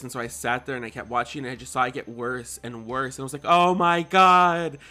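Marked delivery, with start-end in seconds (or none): 3.4-4.8 shocked. Retold or imitated. Retold shocked reaction, young viewer.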